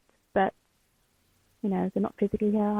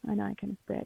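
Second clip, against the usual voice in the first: softer, quicker, to herself; softer repeat; 8 dB quieter, much faster than usual. Real, not acted. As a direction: murmur it again, quickly, to herself. Repeats line softer, faster.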